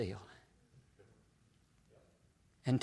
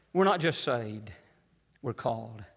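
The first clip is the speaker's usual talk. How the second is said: usual speaking voice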